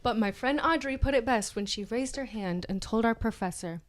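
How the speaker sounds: normal talking voice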